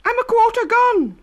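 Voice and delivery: very high, loud, panicked cry; shrill loud panic; frightened cry. A narrator's reading. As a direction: wail while being eaten. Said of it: "Then he cried".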